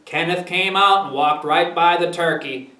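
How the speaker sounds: plain reading voice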